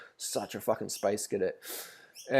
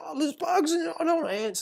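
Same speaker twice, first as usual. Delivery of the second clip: much higher, hammed-up panicky whine; mock freaking-out tourist. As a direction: play the tourist losing it over ants, pitched way up. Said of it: "and he's freaking out".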